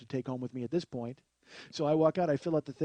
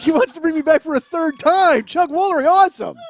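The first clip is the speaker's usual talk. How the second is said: loud, high-pitched gushing; over-the-top excitement; 12 semitones higher, 14 dB louder than usual. Pretend. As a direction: shout it like an overjoyed fan. Mock delight at being invited back.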